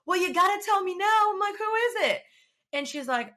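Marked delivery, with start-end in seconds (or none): 0.1-1.3 high, loud, insistent demand; urgent pleading. Retold or imitated. Presses friend to tell. 1.6-2.2 very high, impatient question; urgent demand. Retold or imitated. Presses friend to tell.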